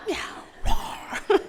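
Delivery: giggling through the animal sounds; laughing. Real, not acted. Teacher laughing at her own jaguar sounds. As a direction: laugh while you say it.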